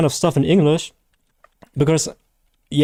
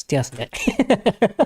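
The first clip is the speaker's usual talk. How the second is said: high nasal try at an American accent; mock American voice, high; American accent attempt; 6 semitones higher, 3 dB quieter than usual. Shown as a demonstration. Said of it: "it sounds a little ridiculous".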